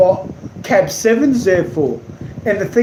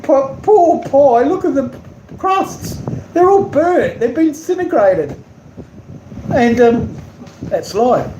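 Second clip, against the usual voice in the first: mock pity; 6 semitones higher than usual. Real, not acted. Mock pity for burnt pie.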